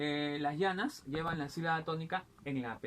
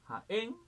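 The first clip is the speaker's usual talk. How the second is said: Jaén with a high, rising ÉN; stress on last syllable; 6 semitones higher, 3 dB louder than usual. Shown as a demonstration. Teacher showing palabras agudas, Jaén stressed on ÉN. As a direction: lift the final syllable of Jaén. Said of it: "termina en la última sílaba".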